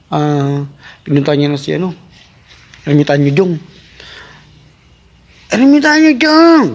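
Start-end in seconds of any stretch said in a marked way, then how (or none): 5.5-6.7 high, loud and slow calling out; king shouting for the hermit. Acted. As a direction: yell up the mountain for the hermit. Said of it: "Huwag kang sumigaw".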